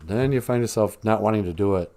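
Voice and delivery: ordinary talk, nothing marked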